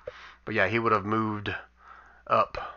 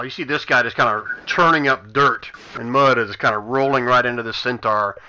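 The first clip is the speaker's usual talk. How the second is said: louder, animated combat narration; game master's scene setting; 2 semitones higher, 7 dB louder than usual. Acted. Game master describing a brutal hit to players, animated narration. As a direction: narrate the blow with energetic force.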